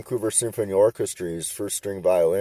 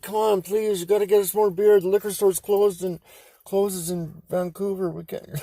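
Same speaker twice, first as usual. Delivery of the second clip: higher, whiny mock begging for beer; begging teens; 9 semitones higher than usual. Drunk teens begging the mom for more beer, whiny. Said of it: "We're like".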